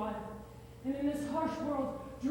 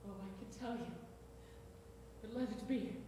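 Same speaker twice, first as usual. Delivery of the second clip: faint dying voice; 5 semitones lower, 11 dB quieter than usual. Acted. Dying Hamlet fades.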